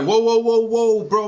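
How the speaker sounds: loud startled whoa to stop him; shouting to halt a friend mid-gulp; alarmed shout. Retold or imitated. To a friend gulping rum, a loud alarmed shout.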